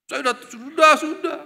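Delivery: mock-insist you're already saved.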